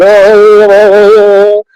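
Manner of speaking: nervous hesitation. Retold or imitated. Acting out a closed-hearted person.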